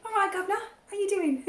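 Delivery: chirpy cockney greeting; mock cockney. Laughing while riffing on the cockney bit. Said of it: "Dave Anthony's is like".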